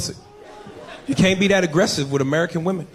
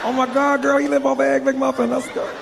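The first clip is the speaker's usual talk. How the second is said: very high mock-female squeal; impressed woman; 9 semitones higher than usual. Woman believing his made-up English address, high gushing voice. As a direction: gush high, as a hammed-up smitten woman.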